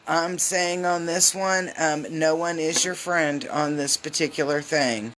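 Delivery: usual speaking voice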